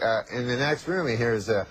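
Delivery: normal talking voice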